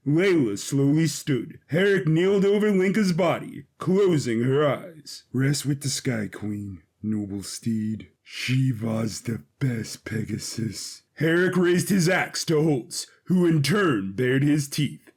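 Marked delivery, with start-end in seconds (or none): 5.3-11.0 lower, softer, slow farewell; quiet grief. Acted. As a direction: mourn the steed gently. Herrick mourning his dead steed.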